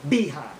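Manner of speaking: BE-hind, first syllable punched; stress shifted to first syllable. Shown as a demonstration. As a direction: hit the "be" in "behind". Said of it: "we change the emphasis".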